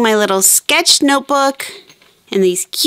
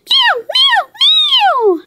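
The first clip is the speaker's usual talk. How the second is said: very high little meows; kitten meowing. Acted. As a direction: squeak it like a tiny kitten. Cat says yes.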